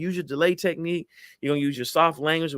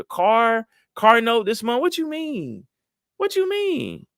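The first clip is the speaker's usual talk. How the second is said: high-pitched indignant protest; mock indignation; 8 semitones higher, 3 dB louder, slower than usual. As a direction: climb into a squealed, hammed-up complaint. Acted indignant date, pressing for sex.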